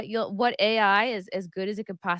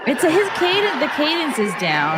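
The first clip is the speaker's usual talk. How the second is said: laughing; 9 semitones higher, 10 dB louder than usual. Real, not acted. Laughing at the AI Carlin clip.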